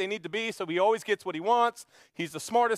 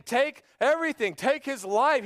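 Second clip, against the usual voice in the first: raised, higher furious demand; furious king; 6 semitones higher than usual. Acted. King David demanding the rich man's death, furious. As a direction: demand his death in outrage. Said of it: "He's furious, he's irate".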